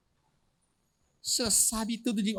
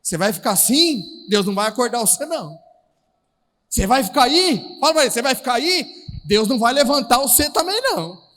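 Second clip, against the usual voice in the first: loud challenge; 8 dB louder than usual. Real, not acted. Challenging the congregation.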